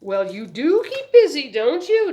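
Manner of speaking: high, loud, chirpy socialite; bright-spirited, showy interest; wonderful cheerful voice. A narrator's reading. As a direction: gush cheerfully at a little boy. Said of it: "in her wonderful, cheerful voice".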